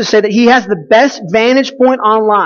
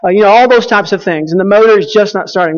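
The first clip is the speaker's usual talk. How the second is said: usual speaking voice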